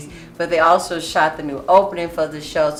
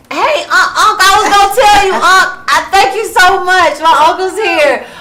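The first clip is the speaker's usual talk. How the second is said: high, loud and gushing; excited shout-out; 13 semitones higher, 10 dB louder than usual. Acted. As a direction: call out to your uncle with excited joy. Excited message to her uncle.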